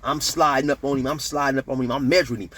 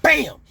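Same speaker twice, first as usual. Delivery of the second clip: high, loud "bam" on impact; imitated body slam; 14 semitones higher, 6 dB louder than usual. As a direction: slam the sound down. Voicing slam on the ground.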